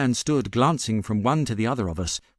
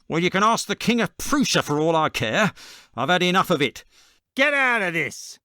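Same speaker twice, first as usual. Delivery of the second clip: angry shout; 6 semitones higher than usual. A narrator's reading. Salesman ordering the man away.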